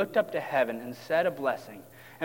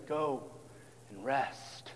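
soft, low release; gentle Jesus; 4 semitones lower, 11 dB quieter, much slower than usual. Acted. Jesus to his weary disciples, gentle and low. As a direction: ease off, with a pause before the last word. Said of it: "seen his disciples weak and weary from the journey".

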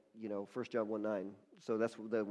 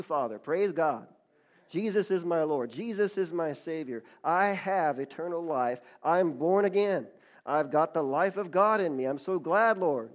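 rising, declamatory praise to God; fervent prayer; 3 semitones higher, 7 dB louder than usual. Retold or imitated. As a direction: preach it out as heartfelt thanks. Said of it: "He began just talking to God".

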